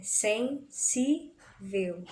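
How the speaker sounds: sen-sí-vel, very slow with gaps; separação silábica. Shown as a demonstration. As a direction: break sensível into three separate syllables. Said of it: "na separação silábica". (sensível bem devagar, em três sílabas separadas)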